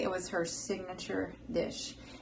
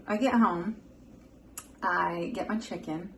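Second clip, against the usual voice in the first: usual speaking voice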